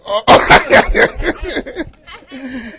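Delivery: high-pitched burst of laughter; hearty laugh. Real, not acted. Laughs after his own joke.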